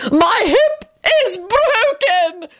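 high, drawn-out wailing scream; screamed from the floor. A narrator's reading. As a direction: wail it in agony, stretching the words. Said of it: "Lady Fanshawe screamed from the floor".

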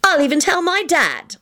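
high-pitched stubborn threat; defiant. Acted. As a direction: throw it out as a dare. Defiant Elizabeth escalates her threat.

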